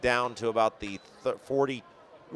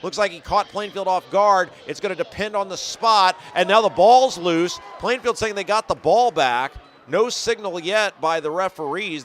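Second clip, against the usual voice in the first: higher, louder, fast live call; excited play-by-play; 5 semitones higher, 5 dB louder than usual. Real, not acted. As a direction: rise with the action on the field.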